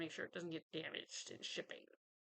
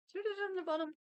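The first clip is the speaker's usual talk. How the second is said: much higher and louder with delight; excited; 14 semitones higher, 7 dB louder, much faster than usual. Real, not acted. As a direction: show it off with delight. Excited discovery of the gift.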